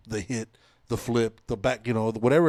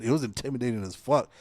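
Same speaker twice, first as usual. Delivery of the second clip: ordinary delivery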